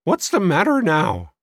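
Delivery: high, cracked irritated woman; demanding. A narrator's reading. Sister called away by her brother, demanding. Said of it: "she demanded".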